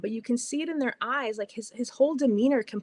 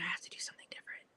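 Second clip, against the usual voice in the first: breathless fast whisper to herself; a quick secret whisper of resolve; whisper to self; 2 semitones lower, 15 dB quieter, much faster than usual. Retold or imitated. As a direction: hurry it out under your breath. Inner resolve at the register.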